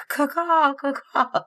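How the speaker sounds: high crow caw call; bird call. Pretend. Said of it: "like, bird call".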